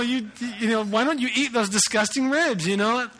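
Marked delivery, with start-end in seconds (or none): 0.8-2.7 higher, louder mock rant of the angry counter lady; retelling the lady telling him to eat the ribs, mock angry; mock flipping out. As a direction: act out her snapping at you, hammed up. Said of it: "she just flipped out".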